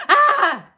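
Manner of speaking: sudden high loud yelp of fright; startled scream. Retold or imitated. Startled by a bat, she lets out a sudden shriek. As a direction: shriek as a bat zooms past. Bat flies past her head.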